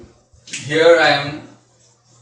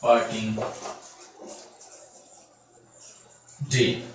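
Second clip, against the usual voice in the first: poem recitation; 2 semitones lower, 10 dB quieter than usual. Shown as a demonstration. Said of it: "And how the line goes".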